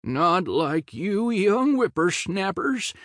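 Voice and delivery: put-on high, very slow wobbly old man; quavering old man voice. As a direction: creak it out like a frail grandpa. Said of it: "Drew put on a quavering old man voice".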